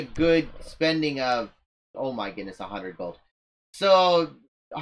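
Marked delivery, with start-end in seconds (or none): 1.9-3.2 low, soft under-the-breath sigh; muttered aside. Real, not acted. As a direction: mumble it as a rueful aside. Muttered aside about the cost.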